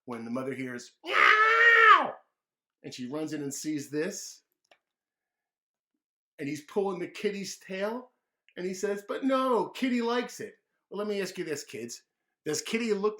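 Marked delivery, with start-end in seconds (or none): 1.0-2.2 high shrieking meow; loud cat screech; loud cat yowl. Acted. Cat cries out. 9.1-10.6 put-on high, loud childish protest; high little-boy excuse; kid protest. David pulling cat's tail.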